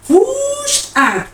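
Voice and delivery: high, long-stretched push; straining push. Retold or imitated. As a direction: draw the word out high as if bearing down. Acting out her hard push in labor.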